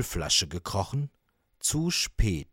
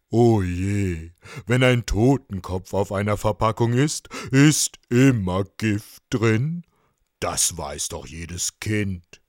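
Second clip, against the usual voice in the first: put-on elderly octopus voice, dismayed; old octopus, concerned; 6 dB louder than usual. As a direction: lament like a wise old sea creature.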